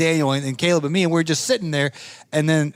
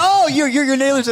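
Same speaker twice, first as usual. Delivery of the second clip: high, louder oh-you're-that-guy; mock surprised fan recognizing someone; surprised recognition; 8 semitones higher, 4 dB louder than usual. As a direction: act like you just placed him. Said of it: "he's like".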